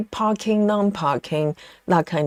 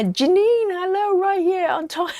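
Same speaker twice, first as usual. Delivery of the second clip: much higher, excited greeting; voice goes up; 11 semitones higher than usual. Real, not acted. Host greeting a favourite viewer, pitched way up. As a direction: squeal a delighted hello up high. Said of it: "I don't know why my voice goes up".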